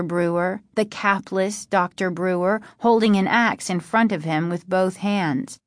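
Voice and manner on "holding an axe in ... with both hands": ordinary delivery, nothing marked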